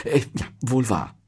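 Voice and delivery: halting, sheepish concession; mildly deflated agreement; reluctant admission. A narrator's reading. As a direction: concede it grudgingly. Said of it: "gab Zettel zu".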